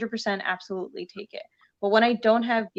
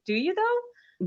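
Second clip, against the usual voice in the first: sceptical comeback; 11 semitones higher, slower than usual. Retold or imitated. Replying to eager young comics.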